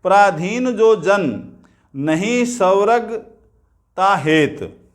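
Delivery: slow verse, जन held, break before "नहीं स्वर्ग"; pause after जन. Shown as a demonstration. Teacher showing where the verse halts, pause after जन. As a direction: stop at the comma after "प्राधीन जो जन". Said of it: "रुक गए".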